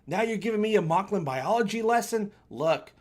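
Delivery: ordinary talk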